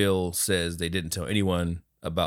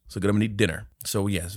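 normal talking voice

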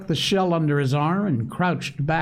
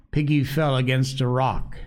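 ordinary delivery

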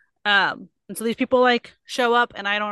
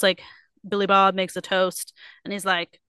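ordinary talk, nothing marked

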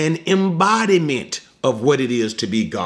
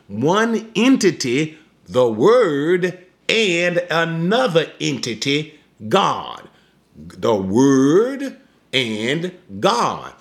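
slow emphasis; 3 semitones higher, slower than usual. Shown as a demonstration. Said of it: "let me slow it down again here".

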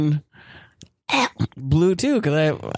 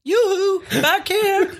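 calling out; 13 semitones higher, much slower than usual. Acted. Passenger calling flight attendant.

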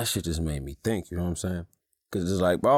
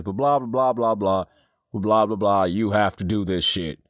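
louder, higher mocking nag; mocking blah blah; 3 semitones higher, 5 dB louder than usual. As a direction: mimic a nagging wife, dismissively. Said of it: "'cause all I hear is".

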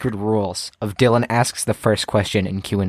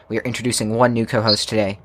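ordinary delivery

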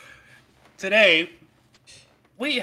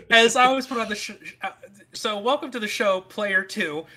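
giggling through the words; can't stop laughing while talking; 5 semitones higher, 4 dB louder than usual. Real, not acted.

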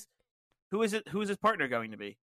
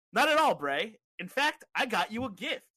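weirdly chipper, high-pitched reply; Sami Zayn; 8 semitones higher than usual. Acted. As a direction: answer the host with perky, raised pitch. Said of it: "He looks weirdly chipper".

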